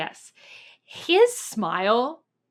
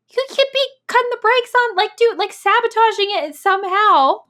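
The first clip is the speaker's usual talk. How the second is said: alarmed; 12 semitones higher, 7 dB louder than usual. Real, not acted. Alarmed speculation.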